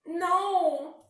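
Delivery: high, loud wailing no; upset no. A narrator's reading. Bear cub upset his hat is still ruined, high and loud. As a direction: wail it out. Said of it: "said Douglas".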